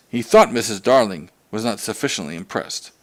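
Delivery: plain reading voice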